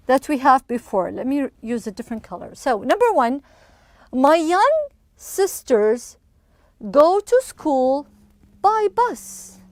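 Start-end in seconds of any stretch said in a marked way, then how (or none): 4.1-9.6 slow, phrase by phrase with pauses; slow model reading. Shown as a demonstration. Teacher reading a sentence to pupils, slow and separated. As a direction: read it out slowly for learners to follow.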